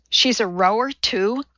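ordinary delivery, nothing marked

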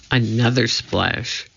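ordinary delivery, nothing marked

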